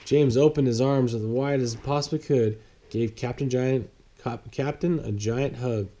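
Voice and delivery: ordinary delivery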